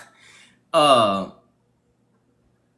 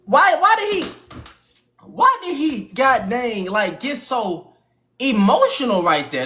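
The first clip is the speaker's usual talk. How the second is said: high, strained, ranting; crashing-out old man; 10 semitones higher than usual. Retold or imitated. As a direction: rant like a fed-up old man.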